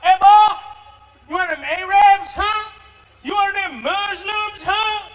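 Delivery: loud, high Texan holler; rough Texan. Acted. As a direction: challenge him in a Texas accent, shouting. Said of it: "It can get rough in Texas".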